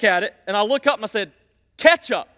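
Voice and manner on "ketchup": loud, high, blunt one-word demand; aggressive ketchup. Retold or imitated. Pastor demanding ketchup from a cashier, loud and blunt. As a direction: bark ketchup at the cashier like an order. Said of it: "I'm trying to say it aggressive".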